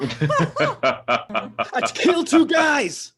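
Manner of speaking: very slow, loud, laughing confession; mock-serious shout. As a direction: drag it out and shout it.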